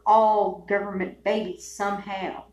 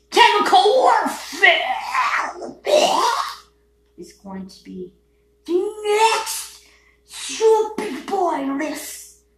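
ominous outburst; 8 semitones higher, 6 dB louder, slower than usual. Real, not acted. Ominous warning.

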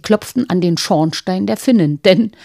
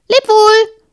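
much higher, loud farewell; cheerful farewell call; 14 semitones higher, 7 dB louder than usual. Acted. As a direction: call out goodbye brightly. Robber girl bids farewell.

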